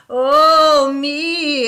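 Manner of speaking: high, loud ghostly wail from the chimney. A narrator's reading. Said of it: "a sad voice wailed".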